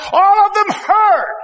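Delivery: high, slow preacher's shout; high slow preaching shout; preaching climax. Real, not acted. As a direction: ring it out to the back pews.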